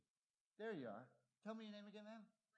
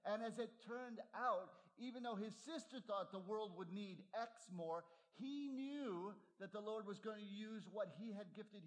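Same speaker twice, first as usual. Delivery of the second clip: higher, louder pulpit preaching; full-force preaching; 6 semitones higher, 4 dB louder than usual. Acted. Preacher rousing the congregation about Eric Liddell, full preaching. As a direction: drive the point home to the pews.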